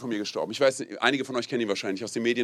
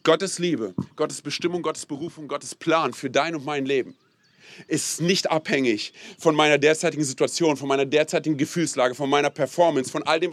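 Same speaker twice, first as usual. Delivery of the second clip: ordinary talk, nothing marked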